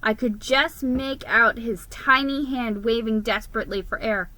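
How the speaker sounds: ordinary delivery, nothing marked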